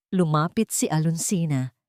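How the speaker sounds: plain reading voice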